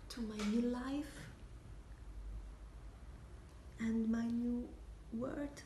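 halting, with long dreamy pauses between phrases; hushed, lingering stage confession; wistful monologue. Acted. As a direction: drift through the daydream, letting it fade softly at the end.

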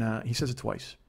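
normal talking voice

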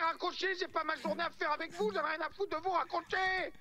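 worked-up, insistent phone rant; angry outburst. Acted. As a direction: lose patience and order them off the line. Angrily orders caller to hang up.

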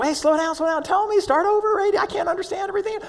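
put-on calm down an excited child; lightly voiced mom. Mom calming her excited son.

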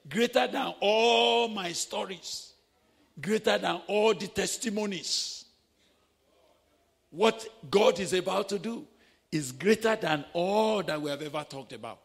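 loud, rising sermon proclamation; preaching at full force. Acted. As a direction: proclaim it to the congregation with full power.

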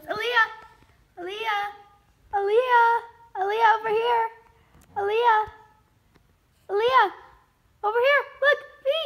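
loud, high repeated calls of her name; calling out. Real, not acted. Mother calls the baby toward the camera, loud high calls. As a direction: shout her name across the room to get her attention.

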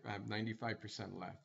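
ordinary talk, nothing marked

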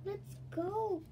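urgent. Acted. Kirsty urging escape.

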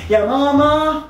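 high call for mom. Acted. Little brother calls mom to tell on sister, calling out. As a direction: shout for mom to come.

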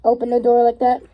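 muffled behind rubber mask; talking through a face mask. Real, not acted. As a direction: voice inside breathing mask. Seen 3: Green mask pressed over mouth and nose by hands throughout.

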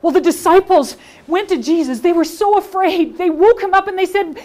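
put-on expressive Bible story for children, big shifts in pitch and volume; animated children's storyteller; lively storytelling. Shown as a demonstration. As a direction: tell it with full drama and energy. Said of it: "this time I'm going to use all of the skills".

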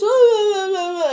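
loud, high mock bawling; exaggerated crying wail, falling away. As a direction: imitate a tantrum sob. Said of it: "Stephanie leaves and goes".

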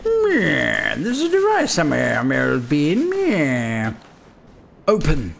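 lightly voiced scheming villain; a hint of a sneering villain; mustache twirling villain. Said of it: "That's how he sounds".

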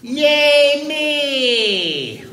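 high, loud, drawn-out cheer; self-cheer. Pretend. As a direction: celebrate yourself with a held yell.

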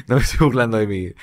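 quick nervous laughing words; laughing from nerves, fast. Real, not acted. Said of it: "Me río de nervios".

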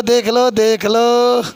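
very slow, stretched repeated call; drawn-out urging. Real, not acted. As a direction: urge the class along, lengthening each word. Urging class to look, chanting.

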